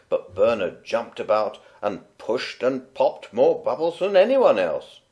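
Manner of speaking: ordinary delivery, nothing marked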